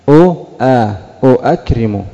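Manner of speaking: أو، آ apart, then joined as "u-akrimu" in أؤكرم; heavy double hamza. Shown as a demonstration. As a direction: spell out both glottal stops before saying أؤكرم. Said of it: "ثقيلة أو خفيفة؟". (أؤكرم مع فصل الهمزتين: أُ ثم أَ)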